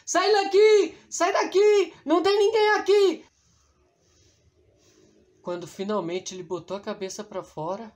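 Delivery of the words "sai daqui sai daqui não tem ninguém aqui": high, loud panicked yelling; frightened shouting. Acted. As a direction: scream it from hiding, terrified. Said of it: "ficava gritando".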